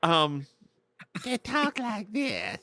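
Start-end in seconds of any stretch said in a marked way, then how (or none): 1.2-2.6 lightly voiced high, slow Capote drawl; a hint of an old Southern gent, slowed down; Truman Capote voice. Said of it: "that's my Truman Capote voice".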